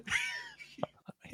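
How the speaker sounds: laughing whisper. Real, not acted. Laughing whisper mid-joke.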